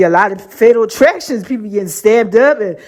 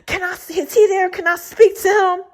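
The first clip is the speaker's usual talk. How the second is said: the nurse's voice; 7 semitones higher than usual. Retold or imitated. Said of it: "she was like this".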